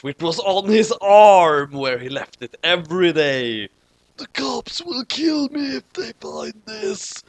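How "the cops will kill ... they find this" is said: high, slow panicked Kira voice; lightly voiced panic; screamed line. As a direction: wail it out in a high put-on voice. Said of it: "he screamed".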